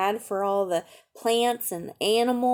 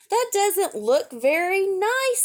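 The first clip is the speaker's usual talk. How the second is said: put-on higher, slow little girl's voice; child character voice; 9 semitones higher than usual. A narrator's reading. Reading to a child, voicing the girl with mild dismay.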